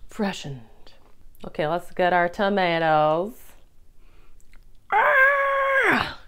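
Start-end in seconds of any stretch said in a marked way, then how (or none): 4.9-6.2 sudden high delighted cry on Ah; delighted squeal. Real, not acted. Cooking vlogger squeals over a young tomato, delighted.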